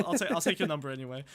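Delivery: normal talking voice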